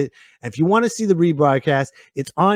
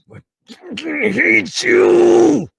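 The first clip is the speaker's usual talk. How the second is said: strained mock hatred; 12 semitones higher, 4 dB louder, much slower than usual. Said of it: "You're like".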